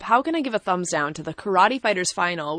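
normal talking voice

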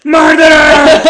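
high, full-blast imitation scream; screamed accusation. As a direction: belt it out as a shrieking wrestling manager. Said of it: "just starts screaming".

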